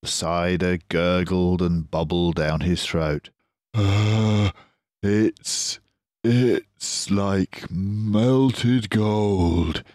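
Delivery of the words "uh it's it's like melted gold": very slow, awed, drawn-out Badger; gasping Badger. Badger marvels at cider as melted gold. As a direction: savour it breathlessly. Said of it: "he gasped".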